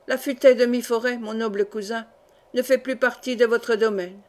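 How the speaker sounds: usual speaking voice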